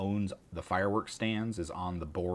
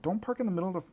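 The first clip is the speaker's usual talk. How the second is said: mock scolding; 10 semitones higher than usual. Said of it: "I'm like".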